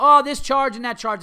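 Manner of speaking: hammed-up high voice listing fees; mocking the vet. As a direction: parody her rattling off charges, high and loud.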